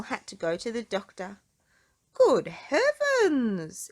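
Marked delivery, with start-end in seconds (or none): 2.1-3.9 slow, higher, louder aghast cry; shocked exclamation. Acted. Doctor Make-You-Well seeing Mister Small, a slow shocked cry. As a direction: gasp it out in shock. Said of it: "exclaimed Doctor Make-You-Well".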